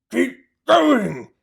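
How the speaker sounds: loud, high gruff farmer shout. A narrator's reading. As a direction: bark it over the engine noise. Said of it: "the fat Bogus shouted".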